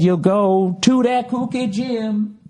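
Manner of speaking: high, slow sing-song mock; hammed-up mocking imitation. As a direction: taunt it in a goofy voice. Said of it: "I said".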